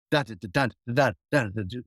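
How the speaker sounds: low, soft "da" syllables that fade away; imitating Sly jiving to the music; scatted groove. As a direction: hum Sly's groove quietly, dying out. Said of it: "Sly starts going".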